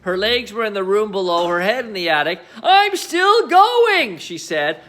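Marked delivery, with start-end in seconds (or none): 2.6-4.2 put-on high, slow squeaky old-woman voice; squeaky grandma. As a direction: squeak it out from far above. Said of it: "with her squeaky voice from above".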